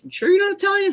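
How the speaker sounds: squeaky imitation of the boss; high mock boss voice. Retelling the boss at work, high mock voice. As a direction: pitch way up, teasing the boss's question. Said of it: "He's like".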